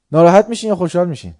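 normal talking voice

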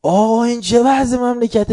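high, loud scolding shout; angry shout. Retold or imitated. Cleric shouting at the shah about the country. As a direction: yell the question at the king.

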